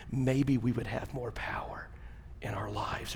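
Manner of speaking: slow, breathy hushed whisper. Acted. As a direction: whisper it like a secret blessing. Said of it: "ends the testimony in a whisper".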